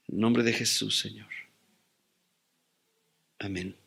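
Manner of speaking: soft, low prayer with long pauses. Real, not acted. Pastor asking the Lord for wisdom with loved ones, quiet prayer. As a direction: pray quietly, sinking to a hushed amen.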